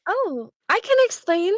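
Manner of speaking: high, sweet offer to explain; super sweet. Acted. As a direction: pipe up with a sugary smile. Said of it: "like, super sweetly at the guards".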